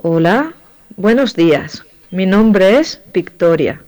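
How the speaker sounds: ordinary talk, nothing marked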